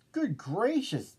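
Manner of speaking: high-pitched astonished exclamation; storybook bird taken aback; gasped surprise. A narrator's reading. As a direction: gasp it out in mild shock. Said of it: "gasped Maisie".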